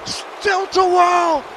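excited shouting commentator; play-by-play shouting. Acted. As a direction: yell the call like live ringside. Live wrestling play-by-play.

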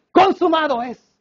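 high, slow victorious declaration; forceful cry. Acted. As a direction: proclaim it with strength, drawn out. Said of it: "Él tomó la fuerza de decir".

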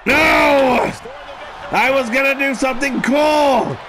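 frustrated shout. Acted. Frustrated outburst in game.

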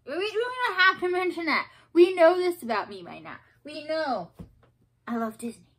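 mock-scolding herself, loud and high; playful exclamation. Real, not acted. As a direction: exclaim it at yourself, laughing at the habit. Teasing herself about Disney.

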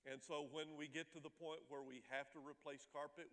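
normal talking voice

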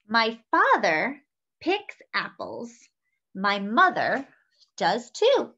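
slow, clear storybook reading; reading to children. A narrator's reading. Host reading a picture book to children, bright and measured. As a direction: read it warmly to little listeners.

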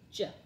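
J as a short "juh"; letter sound. Shown as a demonstration. Teacher drilling letter sounds, J as "juh".

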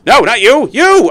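shrill panic yell at a bat; high frantic shout. Real, not acted.

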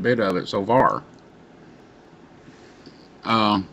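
talking around pipe stem; pipe in mouth. Real, not acted. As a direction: lips clamped on pipe. Seen 0: Pipe held at chin first, stem in mouth from 0.8s.

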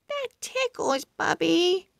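put-on high, soft sleepy girl voice; sleepy giggly girl. Girl woken by pug licks, high and soft, half asleep. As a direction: murmur it half asleep and tickled.

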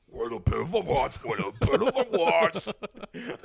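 loud, garbled taunting imitation; hammed-up mockery of a customer's words; mocking mimicry. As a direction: parrot it back in a ridiculous voice. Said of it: "the way you said that".

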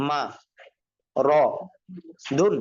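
syllable by syllable, long pauses between "মা", "র", "দুন"; broken pronunciation. Shown as a demonstration. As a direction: sound out "মা ... র ... দুন", each alone. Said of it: "ভাঙ্গা উচ্চারণ কিভাবে?".

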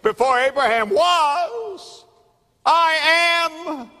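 high, loud and slow, "I am" rings out; ringing proclamation. Acted. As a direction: proclaim it to the rafters. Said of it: "He is saying".

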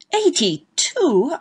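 slow, high, drawn-out shock; shocked exclamation. A narrator's reading. Husband hearing his wife's age, drawn-out high shock. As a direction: reel at the number. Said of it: "I ejaculated".

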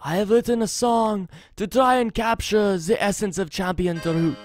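put-on lilting, thickly accented bard voice; measured, musical accented delivery of a wandering minstrel; travelling bard with foreign accent. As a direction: read it as a courtly accented bard.

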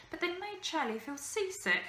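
ordinary delivery, nothing marked

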